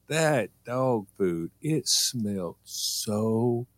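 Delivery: very slow, with a pause before BAD; drawn-out emphasis. Real, not acted. Storyteller setting up the awful smell, drawn out. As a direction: stretch it out and hold back the last word.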